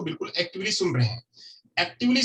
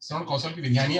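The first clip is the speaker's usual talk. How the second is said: ordinary delivery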